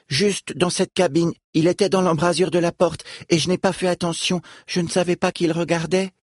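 a lightly voiced young girl. Falom speaks at length.

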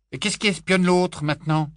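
high, loud accusing cry. A narrator's reading. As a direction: throw the question back at her, shouting. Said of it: "s'écria-t-il". (il s'écrie, accusateur, aigu et fort)

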